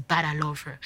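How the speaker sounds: calm the guilt with gentle love; tender reassurance. Retold or imitated. God repeating his love for her.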